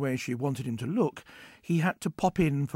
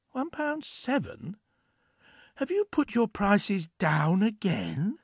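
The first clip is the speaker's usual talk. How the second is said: incredulous; 5 semitones higher, slower than usual. Acted. Jeff surprised at low price.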